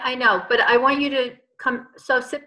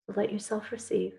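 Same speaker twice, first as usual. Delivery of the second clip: guided meditation. Acted. Leads group healing meditation.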